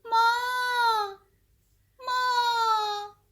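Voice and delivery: very slow, high, loud drawn-out cries; loud calling. A narrator's reading. Baby bird calling out loudly to the boat. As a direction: stretch each "माँ" into a long loud call. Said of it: "बहुत ज़ोर से पुकारा".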